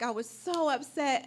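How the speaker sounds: ordinary delivery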